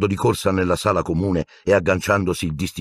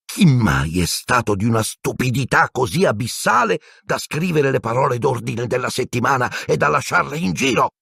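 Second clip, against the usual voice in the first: shocked fury; 7 semitones higher than usual. Acted.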